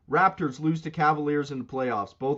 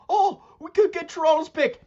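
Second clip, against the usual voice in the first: high, loud mock excitement; mocking fan voice; 13 semitones higher, 5 dB louder than usual. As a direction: imitate a deluded rival fan, pitched way up. Mocks hopeful Boston fans.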